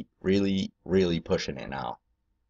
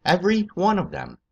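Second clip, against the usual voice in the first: much higher, pushed-up repeat of Every one; emphatic repeat; 10 semitones higher than usual. Real, not acted. Ranting to viewers about presidents, high emphatic repeat.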